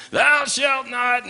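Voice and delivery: slow, high, drawn-out divine boom; booming voice of God. Acted. God from the mountain, booming down at the people.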